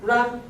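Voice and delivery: ra said on a high tone. Shown as a demonstration. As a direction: raise the voice for ra. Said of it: "With up accent".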